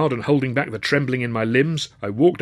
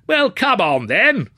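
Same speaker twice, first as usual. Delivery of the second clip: loud, high impatient heckle; heckling; 11 semitones higher, 7 dB louder, slower than usual. A narrator's reading. Rude student heckles the silent speaker, impatient. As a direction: heckle from the back. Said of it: "came a voice from the back".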